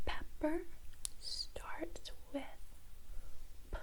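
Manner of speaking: barely audible whisper; whispered prompt. Shown as a demonstration. Teacher leaving room for the student's answer, whispering. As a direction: whisper the answer under your breath.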